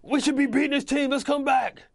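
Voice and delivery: high, loud hammed-up coach pep talk; rally the team like an overexcited coach; imitating coach Singletary. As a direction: coach firing up a losing team at halftime. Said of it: "he's like".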